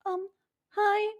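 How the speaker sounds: high, small pitiful voice; mock pitiful caller. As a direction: play the heartbroken caller, meek. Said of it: "I can imagine the call".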